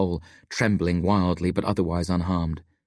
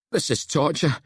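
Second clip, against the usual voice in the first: higher-pitched, groaned complaint; groaning complaint; 8 semitones higher than usual. A narrator's reading. Locke complains while digging out the hole, groaning. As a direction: groan it out, worn down. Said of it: "Locke groans".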